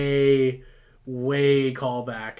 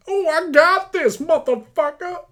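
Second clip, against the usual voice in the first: much higher and louder hammed-up Batman shout; 9 dB louder than usual. Batman taking on the fire, hammed-up loud silly voice. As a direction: bellow it like a goofy superhero. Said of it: "Batman's like".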